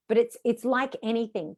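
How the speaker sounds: normal talking voice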